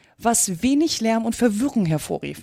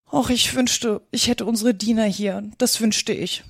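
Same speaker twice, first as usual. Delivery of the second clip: usual speaking voice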